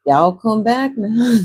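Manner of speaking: loudish, playful Texas hospitality accent. Shown as a demonstration. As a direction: lay on a slow Texas accent. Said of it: "get a Texas accent like a cowboy".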